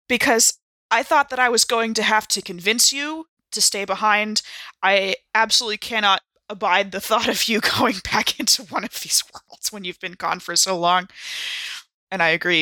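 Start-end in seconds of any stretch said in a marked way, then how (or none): none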